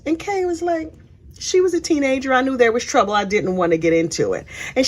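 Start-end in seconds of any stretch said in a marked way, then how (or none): none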